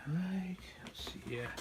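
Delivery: whispering; quiet at night. Real, not acted. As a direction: whisper so the house stays asleep. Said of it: "Wife is sleeping".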